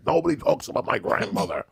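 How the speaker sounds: huffy mock-outrage in a high voice; offended lawyer. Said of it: "And he's like".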